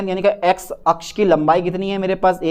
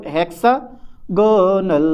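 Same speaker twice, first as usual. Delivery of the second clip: slow drill; much slower than usual. Shown as a demonstration. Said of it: "drilling the term".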